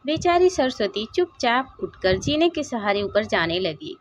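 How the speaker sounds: ordinary delivery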